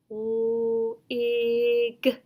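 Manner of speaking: sounds given one by one, apart; teacher modelling separate sounds; segmented sounds. Shown as a demonstration. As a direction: sound out the word in parts. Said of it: "Student responds".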